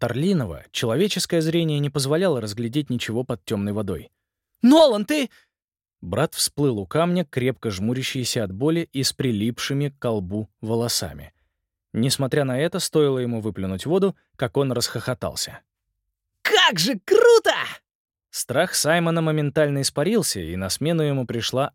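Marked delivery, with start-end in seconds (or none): 4.6-5.3 alarmed shout. Acted. Simon shouts, frightened for brother. 16.4-17.5 excited shout. Acted. Nolan laughing in the water.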